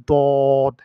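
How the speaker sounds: "দদ" loud, rounded, vowel held long; demonstrated Arabic letter. Shown as a demonstration. Teacher models the letter, loud drawn-out "দদ". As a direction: round and stretch "দদ" forcefully. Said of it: "এভাবে উচ্চারণ করতে হবে".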